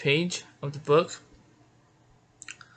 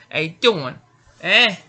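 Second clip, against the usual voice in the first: higher, louder northern-accent greeting; 3 semitones higher, 8 dB louder than usual. Shown as a demonstration. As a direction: put on a punchy northern US accent. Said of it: "something like this".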